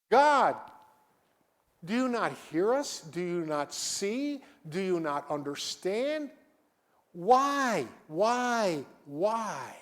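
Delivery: high pleading prayer that fades on each why. Retold or imitated. Congregation's prayer voiced to God, pleading and fading. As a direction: plead with God, then let it sink. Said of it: "we pray".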